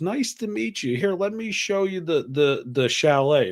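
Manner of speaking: high put-on hostess voice; sing-out mock welcome, slowing at the end; gracious hostess. Hostess greeting guests, retold.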